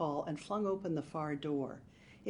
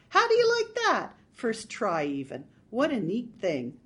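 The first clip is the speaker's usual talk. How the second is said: delighted; 4 semitones higher, 8 dB louder than usual. Acted. Marge delighted after shooting.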